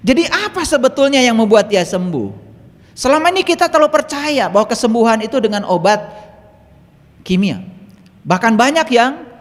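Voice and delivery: high, loud rhetorical preaching; full-force preaching. Acted. Speaker challenges the crowd's faith in chemical medicine, full-force preaching. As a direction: project it to the whole hall.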